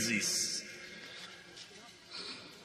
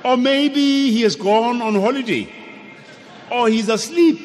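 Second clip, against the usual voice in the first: mocking taunt; 7 semitones higher than usual. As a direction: jeer at the prophets of Baal, voice up high. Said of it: "he's taunting them".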